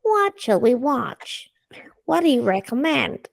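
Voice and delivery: slow, high otherworldly alien; curious alien asking politely, slowly. Pretend. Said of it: "Do an alien voice".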